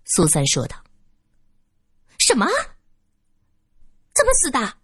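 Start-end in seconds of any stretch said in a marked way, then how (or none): 2.2-4.8 高声惊叫的老妇; 猛地尖声一惊. Acted. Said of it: "女人尖叫起来". (老女人听到死讯尖声惊叫)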